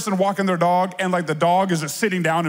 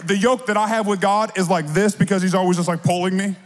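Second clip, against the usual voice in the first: usual speaking voice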